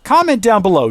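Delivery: ordinary talk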